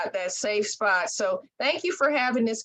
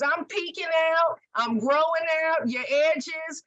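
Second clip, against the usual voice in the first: higher, proud hair voice; the hair announcing it's growing back; stubborn roots talking to their owner; voicing her hair; 6 semitones higher than usual. Hair keeps speaking.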